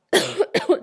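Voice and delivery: loud sudden cough; real loud cough. Real, not acted. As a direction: cough hard mid-sentence.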